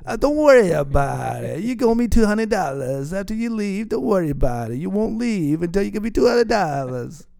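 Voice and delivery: put-on playful Italian accent joking about payment. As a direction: lean into a wiseguy Italian accent. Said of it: "my Italian accent I do sometimes".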